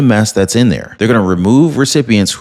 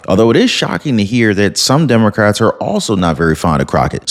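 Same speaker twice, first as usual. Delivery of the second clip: usual speaking voice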